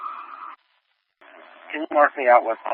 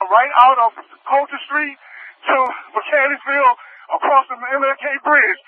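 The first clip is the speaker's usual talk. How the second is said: much higher, pressed route call; urgent call; 12 semitones higher than usual. Real, not acted. Officer calling the escort route to dispatch, urgent and high. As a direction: shout the route over the siren.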